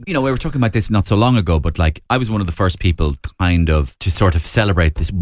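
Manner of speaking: ordinary talk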